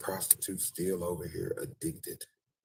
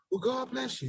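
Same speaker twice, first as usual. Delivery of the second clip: much higher God bless you; polite goodbye while backing off; mock prim blessing.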